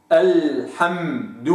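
الحمد slowly, m given full length; held m. Shown as a demonstration. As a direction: let the m in الحمد sit. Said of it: "هكذا".

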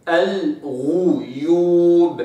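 slow, الغيوب with the غ kept in damma; rounded ghain. Shown as a demonstration. Teacher demonstrating the damma on ghain, slow. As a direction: hold the رounded غ long. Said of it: "إذا أتت مضمومة".

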